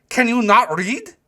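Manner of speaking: put-on high, slow, loud German-accented demand; impatient German doorman, loud and high; thick German accent. A narrator's reading. German doorman to a puzzled customer, loud accented impatience. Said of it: "call out to me in a thick German accent".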